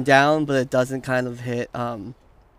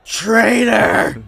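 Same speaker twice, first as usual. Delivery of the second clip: loud, higher furious yell; accusing shout; 6 semitones higher, 7 dB louder than usual. Acted. The captain denounces Baguette to the crowd, a furious shout. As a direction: roar the accusation across the crowd.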